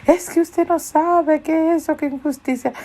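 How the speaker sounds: high-pitched mock outcry of grievance; upset shouting woman. Upset woman complains of injustice to the one calming her, high mock outcry. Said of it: "¿Por qué está gritando?".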